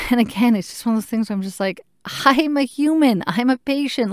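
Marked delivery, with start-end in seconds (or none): 2.3-4.1 high, pleading insistence; frustrated patient pleading; exasperated plea. Retold or imitated. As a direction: insist on being treated as a patient. Frustrated advocacy.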